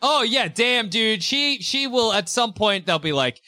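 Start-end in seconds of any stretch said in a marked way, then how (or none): none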